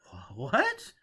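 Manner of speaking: high, stammering what; stunned stammer, high and loud; stunned disbelief. A narrator's reading. Foyle stunned by news.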